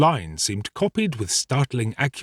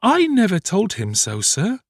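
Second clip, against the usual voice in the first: put-on high boy's voice in amazement; amazed; 10 semitones higher than usual. A narrator's reading. Boy denies giving a false name, amazed. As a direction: protest high, amazed at the question. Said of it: "returned Oliver in amazement".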